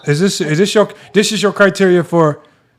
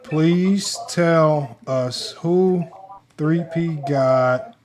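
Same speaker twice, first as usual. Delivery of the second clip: slow dictation; 6 dB quieter, much slower than usual. Real, not acted. Reading out typed prompt slowly.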